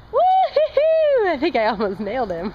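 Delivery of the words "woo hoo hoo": long high cheering whoop; cheering woo-hoo. Real, not acted. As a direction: whoop it out, drawn out. Cheering toddler's hit.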